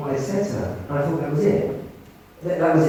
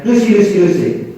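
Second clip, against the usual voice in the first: louder and higher, calling her name insistently; 6 semitones higher, 9 dB louder than usual. Retold or imitated. Creepy man at the social club calling Lucy, loud. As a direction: call after her across the hall.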